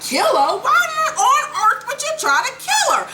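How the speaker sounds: high, squealing falsetto disbelief; mock shock. Ghost hears of plan to kill her, mock shocked squeal. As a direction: squeak it like a mocked, horrified man.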